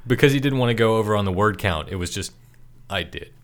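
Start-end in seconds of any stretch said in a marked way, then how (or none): 2.9-3.3 soft, low mock answer; terse deadpan reply. Co-host mocking Tommy's short answer, terse deadpan. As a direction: drop it low and quiet.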